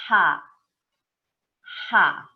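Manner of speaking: breathy "ra" letting air out of the mouth; aspirated h sound. Shown as a demonstration. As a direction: push the air out on "ra". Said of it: "soltei o ar da boca".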